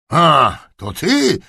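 put-on a startled old duke, drawn out; slow startled grandfather; grumpy old duke. A narrator's reading. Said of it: "zagrzmiał".

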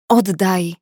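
a mild snarled grab-back; understated growl at a teasing colleague; snapped demand. Acted. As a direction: bark it out, only lightly angry. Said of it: "warknęła".

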